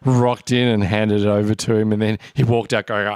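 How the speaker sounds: ordinary delivery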